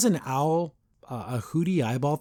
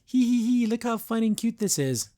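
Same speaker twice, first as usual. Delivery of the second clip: high-pitched, silly put-on giggle voice; tee-hee through it in a high mocking voice; mock cutesy giggler; 7 semitones higher than usual. Reviewer mocks Luz enjoying the fight, cutesy. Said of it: "instead of being, like, a badass".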